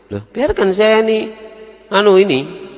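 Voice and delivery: woman's voice. Acted. Woman answers the cleric sharply.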